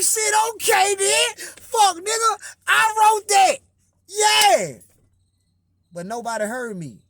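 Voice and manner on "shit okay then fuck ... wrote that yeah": high, loud, pleading yell; slow, strained cry of I wrote that; frustrated shout. Retold or imitated. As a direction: shout it out so the room finally hears you. Retold outburst, nobody heard him.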